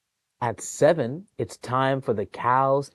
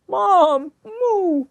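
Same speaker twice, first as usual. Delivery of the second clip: very high, loud calf cry; mooing calf; 9 dB louder than usual. Acted. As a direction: call for mom like a mooing calf. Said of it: "The calves call".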